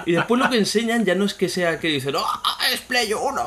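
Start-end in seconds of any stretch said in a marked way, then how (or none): 2.2-3.5 much higher, slower mock-sneering voice. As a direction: lampoon the know-it-all critic. Mocking people who say it. (burla aguda y lenta imitando a un crítico)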